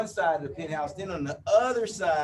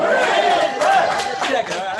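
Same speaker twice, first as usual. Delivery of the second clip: "I'm just checking" drawn out slow, much higher and louder; teasing check; 10 semitones higher, 12 dB louder, much slower than usual. Pretend. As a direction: stretch out "I'm just checking" in a big teasing sing-song. Teasing crowd for weak response.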